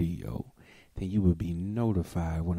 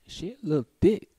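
sly remark; 10 semitones higher, 4 dB louder, faster than usual. Real, not acted. Teasing repeat of a judgement.

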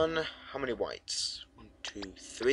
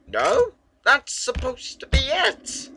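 high, loud, disbelieving protest; exasperated outburst; 8 dB louder, much slower than usual. Real, not acted. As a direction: cry out that it should have worked. Puzzle answer fails.

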